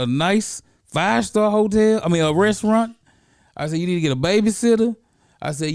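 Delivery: high, loud, urgent instructions; emphatic advice. Retold or imitated. Mentor urging his student, high and loud.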